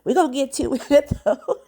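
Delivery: laughing through the words; a tearful laugh breaking in. Real, not acted.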